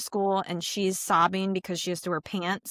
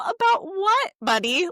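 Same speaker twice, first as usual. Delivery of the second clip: surprised high question; 12 semitones higher, 4 dB louder than usual. Retold or imitated. Mom quizzing her little boy in amused surprise. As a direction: pitch shoots way up on about, a loud amused disbelief.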